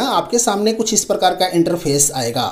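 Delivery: normal talking voice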